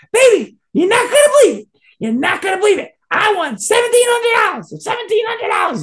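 very high, loud mock-excited voice; overjoyed bragging. Gambler phoning his partner about his winnings, hammed-up elation. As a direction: squeal the big news in an exaggerated high pitch. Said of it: "He's all happy".

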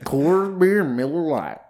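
high, loud mock country twang; hammed-up country drawl; stupid country accent. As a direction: echo it in a silly country accent. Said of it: "stupid country accent a lot".

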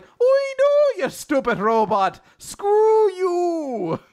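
very high, loud Irish, drawn out; hammed-up exasperated Irish squawk; Captain Connor. Captain corrects his robot pupil's swearing, mock Irish.

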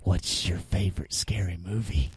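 very low, soft menacing caller voice; Ghostface. Retold or imitated. Prank caller on the belt phone, low creepy voice. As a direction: drop right down into a horror-film phone voice. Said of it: "like, a Scream soundboard".

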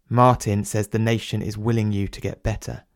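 plain reading voice

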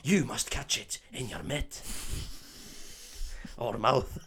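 hammed-up Scottish accent; solemn put-on Scots riddle; Oliver Wood. Keeper advising Harry on catching the ball, Scottish accent. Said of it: "for me to do a Scottish accent".

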